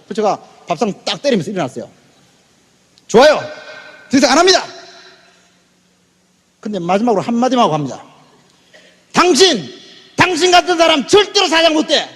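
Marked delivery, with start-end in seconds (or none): 3.1-4.6 loud, high, abrupt refusal; fed-up outburst. Retold or imitated. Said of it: "더 이상 도저히 말을 못 하겠더라고요". 9.1-12.1 high, heated denunciation; angry parting shot. Retold or imitated. 화나서 마지막으로 쏘아붙임.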